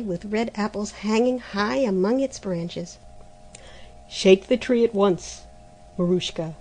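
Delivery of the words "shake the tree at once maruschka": louder firm order; commanding. Acted. The month ordering the girl to shake the tree. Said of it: "commanded the month".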